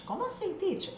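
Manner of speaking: high, soft puzzled student; mock student question. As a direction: play a confused student asking. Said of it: "student's imagined question". (aluno confuso imitado, agudo e baixinho)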